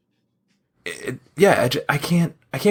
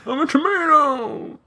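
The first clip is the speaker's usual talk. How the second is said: much higher whiny cartoon voice; whiny tomato; 13 semitones higher than usual. Shown as a demonstration. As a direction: whine it like the tomato kid.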